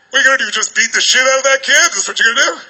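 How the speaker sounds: normal talking voice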